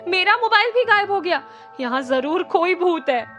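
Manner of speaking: high, loud fearful cry then easing; frightened shouting. A narrator's reading. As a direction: shout it out in alarm. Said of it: "जोर से चिल्लाई".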